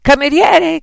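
high, loud summons; calling out. A narrator's reading. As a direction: call the waiter across the bar. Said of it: "chiamò Beatrice".

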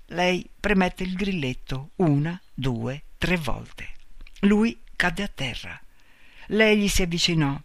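plain reading voice

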